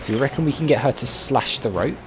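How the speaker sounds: ordinary talk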